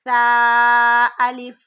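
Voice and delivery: سا الف slow and loud with a soft s; soft drawn-out سا الف. Shown as a demonstration. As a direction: draw out سا الف without whistling. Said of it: "دانتوں کے درمیان میں رکھ کے پڑھیے".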